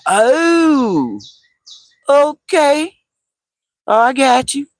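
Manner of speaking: high, drawn-out mock realization; dawning realization. Retelling finally understanding the Egyptian lady, high drawn-out realization. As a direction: stretch it out high as it finally clicks. Acting sudden understanding of her question.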